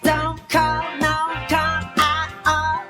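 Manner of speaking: plain reading voice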